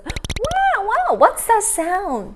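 high, frightened wail and question; scared puppy cry. Acted. Biscuit hiding under the bed, scared high cry. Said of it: "他快吓死了".

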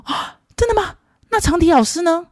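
imitating a startled bandmate; shocked bandmate. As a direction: act out his disbelief, high pitched.

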